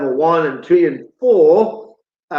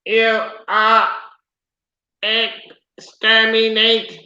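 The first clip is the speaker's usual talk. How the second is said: Dalek voice; 5 semitones higher, slower than usual. Retold or imitated. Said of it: "You are sounding like a Dalek".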